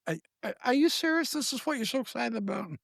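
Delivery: high-pitched, unimpressed disbelief; retold incredulity. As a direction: question it as if badly let down. Retells his disbelief at empty screen.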